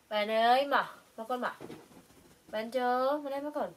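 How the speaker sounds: loud call across the room; calling out loudly. Real, not acted. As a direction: shout to get their attention.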